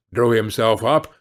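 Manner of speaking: ordinary delivery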